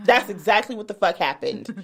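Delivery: ordinary talk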